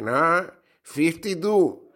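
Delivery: mock nasal retort; hammed-up nasal comeback; nasal punchline.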